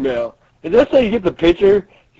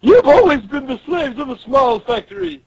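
raised, higher-pitched manager voice; taunting manager; 9 semitones higher, 4 dB louder than usual. Acted. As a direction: lord it over the workers. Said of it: "She's like".

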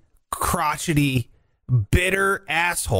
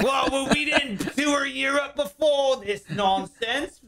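mock [name]; 9 semitones higher than usual. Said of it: "He's like".

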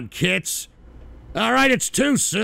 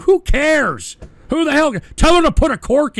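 louder and higher, building to a yell; exasperated rant; 6 semitones higher, 7 dB louder than usual. Real, not acted. Streamer ranting at a donor about his girlfriend, a fed-up shout.

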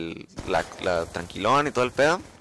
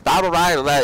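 loud, higher mock gibberish; unintelligible examiner; 5 semitones higher, 5 dB louder, slower than usual. Examiner repeating orders he cannot follow, imitated gibberish. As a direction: fire off garbled syllables, raised and loud. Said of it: "y no le entendí nada".

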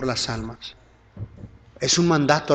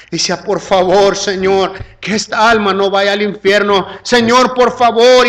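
impassioned retold plea, rising higher and louder; tearful pleading; 4 semitones higher, 6 dB louder than usual. Man praying outside a woman's door for her soul, tearful pleading. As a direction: build into an imploring prayer without real sobbing. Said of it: "llorando con lágrimas, como un niño llorando".

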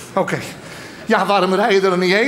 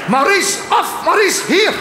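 put-on child calling; 8 semitones higher, slower than usual. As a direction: call the dog like a small boy. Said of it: "een kinderstem die roept".

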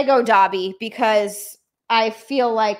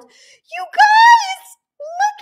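much higher, louder, near-tearful yell of joy; ecstatic shout; 11 dB louder, much slower than usual. Real, not acted. Streamer showing chat the finished Lego Dobby, ecstatic shout. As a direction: scream it with overwhelming delight.

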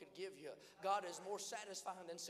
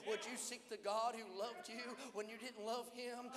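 ordinary delivery

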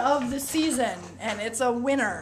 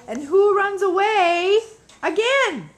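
exasperated; 6 semitones higher, 5 dB louder than usual. Real, not acted. Mother asks child rhetorically.

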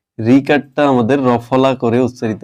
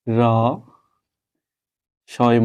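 ordinary talk

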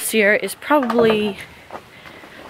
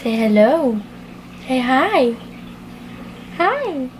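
teaching a parrot; 4 semitones higher, slower than usual. Shown as a demonstration.

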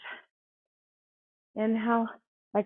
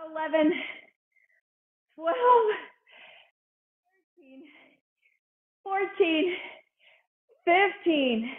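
energetic coaching count; 7 semitones higher than usual. Real, not acted. Pushing class through last reps.

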